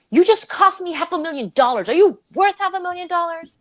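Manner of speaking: high-pitched yell, imitating an enraged manager; retold angry yell. Program manager tearing into her over lost money, angry retold yell. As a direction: act out a furious telling-off, pitch way up. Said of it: "yells at me".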